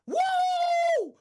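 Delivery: loud high whoop; excited whoop. Real, not acted. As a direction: whoop to hype the clip. Hyped whoop before the video.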